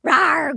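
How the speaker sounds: imitated monster growl. A mild mock growl while narrating the vamp fight.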